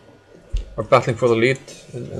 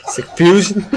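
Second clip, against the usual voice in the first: higher, louder mock echo, breaking into laughter; laughing echo; 8 semitones higher, 6 dB louder than usual. Co-commentator echoing the player's name for fun, laughing. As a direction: repeat the name back, cracking up.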